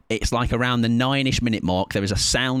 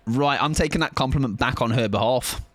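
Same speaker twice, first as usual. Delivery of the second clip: ordinary delivery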